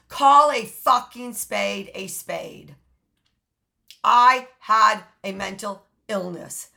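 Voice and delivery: forceful declaration. Real, not acted. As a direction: pound it out so nobody can deny it.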